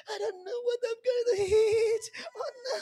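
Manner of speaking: hammed-up simpering girl on a call. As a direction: squeal and giggle down the phone. Girl coyly whining.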